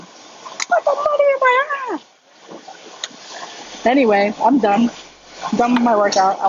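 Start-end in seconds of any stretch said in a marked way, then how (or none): 0.0-3.9 very high, long-drawn squeal; cartoon robber imitation. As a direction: stretch it out in a sky-high cartoon voice. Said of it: "that's how he said it".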